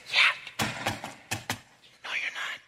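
unvoiced whisper; whispered reply. Holy Spirit answering the proud believer, whispered. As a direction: breathe it as a gentle rebuke. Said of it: "the Holy Spirit saying".

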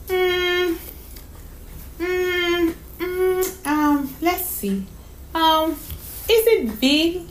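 high, drawn-out thinking hums; child hunting for a question; little girl pondering. Acted. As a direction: hum and hesitate like a small girl.

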